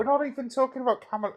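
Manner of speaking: fast, high, fed-up blurt; exasperated outburst. Real, not acted. Friend exasperated at the Kamala tangent, high fast blurt. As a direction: cut him off in exasperation. Frustrated outburst over interruption.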